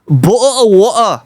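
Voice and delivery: English accent, Ts dropped in "Bottle" and "water"; glottal-stop English accent on "Bottle of water". Shown as a demonstration. User demonstrates for the AI, English accent. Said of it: "do the English accent".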